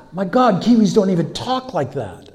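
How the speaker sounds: high, loud retold disbelief; loud, incredulous retold reaction; astonished. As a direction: exclaim it in mock amazement. Retelling his astonished inward reaction.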